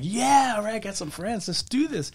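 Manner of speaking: high-pitched, hushed eagerness; retold psyched-up reply. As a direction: act out pumping yourself up, pitch jumping. Acts out getting psyched up.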